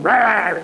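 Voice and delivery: high-pitched mock roar; angry roar. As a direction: imitate a short burst of arguing. Acting out the shouting arguer again.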